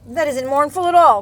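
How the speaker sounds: loud, high indignant cry; cried out. Acted. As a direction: protest loudly and high. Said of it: "cried Bear".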